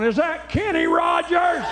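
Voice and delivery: high slow shout from the back; yelling old boy. Retold or imitated. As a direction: holler across the room slowly. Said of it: "some old boy in the back going".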